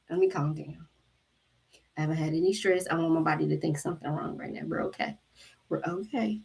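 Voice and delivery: calm yourself down under your breath; self-soothing hush. Retold or imitated. Talking herself down from anger.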